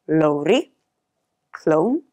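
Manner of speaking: normal talking voice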